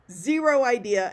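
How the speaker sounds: usual speaking voice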